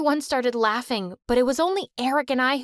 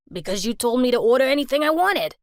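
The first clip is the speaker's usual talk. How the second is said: louder, fast, clearly put-on dad voice; dad's voice, retorting; 4 dB louder, faster than usual. Dad snapping back at mom over takeout, fast and loud. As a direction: snap back quickly in a different gruff voice.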